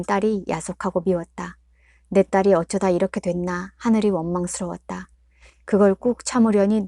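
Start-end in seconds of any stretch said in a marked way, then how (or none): none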